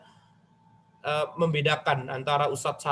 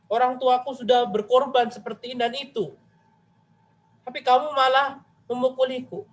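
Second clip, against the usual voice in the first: higher, pleading and hurt, a touch of wounded pride; aggrieved complaint; 5 semitones higher than usual. Retold or imitated. Student complaining to Imam Malik about the beating, higher and aggrieved. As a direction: pour out the grievance to the teacher, lightly acted. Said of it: "curhat nih".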